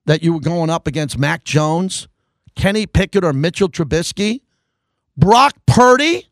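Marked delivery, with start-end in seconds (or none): 5.2-6.3 higher, louder, drawn-out Brock Purdy; disbelieving slow reveal; mock surprise. Real, not acted. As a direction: land the name as the punchline. Punchline in quarterback list.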